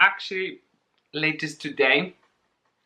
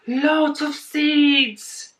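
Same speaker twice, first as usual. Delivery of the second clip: amazed gasp; 10 semitones higher, 3 dB louder, much slower than usual. Real, not acted. Gasp at seeds.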